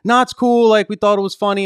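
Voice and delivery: breezy couple. Retold or imitated. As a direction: toss it off like it's nothing. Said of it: "the fact that they were like".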